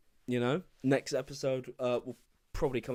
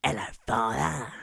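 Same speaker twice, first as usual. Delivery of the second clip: loud, hammed-up villain greeting; Barty Crouch Junior; 6 dB louder than usual. Podcaster mocking a film character's accent, hammed-up villain. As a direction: put on a theatrical villain voice, louder. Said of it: "you hear David Tennant as Barty Crouch Junior".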